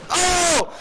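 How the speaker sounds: sharp high exclamation; surprised shout. Real, not acted. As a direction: yelp in surprise. Sudden hit in game.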